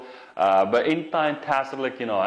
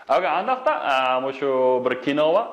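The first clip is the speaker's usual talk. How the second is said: ordinary talk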